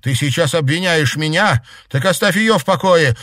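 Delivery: high, loud demand; heated protest. Acted. Girl defending a classmate from her friend, heated shouted protest. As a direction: raise your voice to defend someone.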